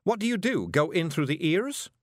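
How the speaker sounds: high-pitched, appalled question; horrified. A narrator's reading. Miss Level asking Rob how they enter heads, horrified. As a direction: ask aghast, starting high then slowing.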